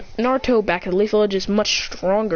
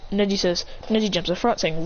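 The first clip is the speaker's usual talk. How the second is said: ordinary delivery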